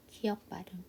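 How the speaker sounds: normal talking voice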